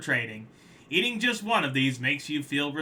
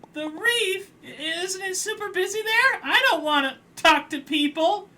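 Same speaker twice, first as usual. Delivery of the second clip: much higher, whiny reluctant crab; 6 dB louder than usual. Acted. Crab dreading busy reef, high whiny voice. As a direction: balk at it, high and put-upon.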